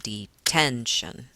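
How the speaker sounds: stomp on the TEN of detention; stressed and unstressed beats. Shown as a demonstration. Teacher showing boot and slipper rhythm, TEN stressed in detention. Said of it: "that's a stressed syllable".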